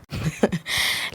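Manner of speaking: short, soft, breathy chuckle; soft laugh. A narrator's reading. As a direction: let out a quiet little laugh. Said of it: "سيف بضحكة".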